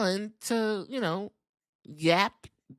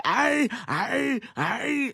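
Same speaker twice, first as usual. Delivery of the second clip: mock pained cry; 6 semitones higher, much slower than usual. Drew voiced being attacked.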